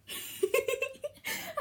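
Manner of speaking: high bubbly giggle. Real, not acted. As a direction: laugh at your own love of bread. Laughing at herself.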